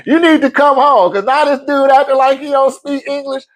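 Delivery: high, urgent mock wife voice; lightly hammed-up worried wife; imitating wife. Wife voiced in retold story.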